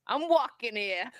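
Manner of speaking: put-on slow, drawn-out New York accent. Showing off her New York accent to the chat, slowly. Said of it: "New York accent always".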